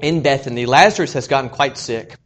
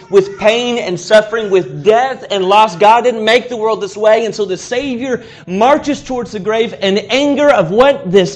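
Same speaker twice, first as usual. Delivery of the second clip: louder, higher fiery sermon climb; preaching at full force; 5 semitones higher, 6 dB louder than usual. Acted. Preacher rallying the congregation against death, full force fiery preaching.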